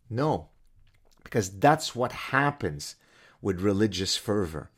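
ordinary delivery

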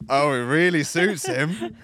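slow, drawn-out mock swoon; mocking girlfriend swooning. He mimics his girlfriend admiring the rapper, slow mock swoon. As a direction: stretch it out like a smitten girlfriend. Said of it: "TikTok clip comes out".

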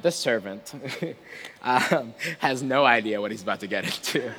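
ordinary talk

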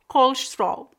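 colesterol clipped to kolʃtɾol; imitating European colesterol. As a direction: squeeze colesterol into two beats. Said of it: "Duas sílabas".

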